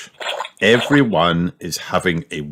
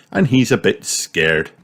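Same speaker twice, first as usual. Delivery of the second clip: ordinary delivery, nothing marked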